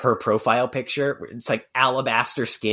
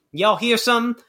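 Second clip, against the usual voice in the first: impression of a liberal tweeting slang; 12 semitones higher, slower than usual. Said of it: "that is my impression of a liberal doing".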